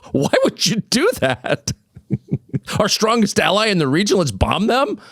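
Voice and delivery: high-pitched incredulous questioning; squeaky, amused bafflement; laughing disbelief. Real, not acted. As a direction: ridicule the idea through laughter.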